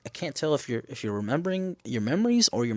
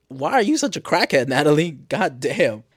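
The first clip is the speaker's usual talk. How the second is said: loud, higher-pitched incredulous scolding; mock exasperation; 4 semitones higher, 6 dB louder than usual. Viewer scolding Natalie for being a crackhead, exasperated. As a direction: call out a character in exasperation.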